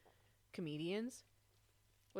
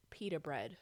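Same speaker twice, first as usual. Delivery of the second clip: ordinary talk, nothing marked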